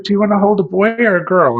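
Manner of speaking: high, loud cheerful clerk; perky question; chirpy clerk. Retold or imitated. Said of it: "She's like".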